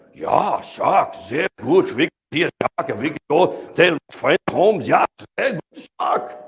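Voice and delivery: lightly voiced German tourist, eager; mock German enthusiasm about the shark; German accent. German diver answering the dive master, eager in a German accent. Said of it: "The German guy's like".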